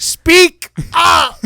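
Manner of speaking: high loud mock yell "Speak up!"; mock crowd yell. Retelling a press conference crowd, mock loud yell. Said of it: "everyone's like".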